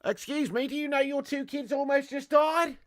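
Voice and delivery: high-pitched Aussie lifeguard impression; Australian accent. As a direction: scold like an Aussie beach lifeguard. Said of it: "I watch a lot of Bondi Rescue".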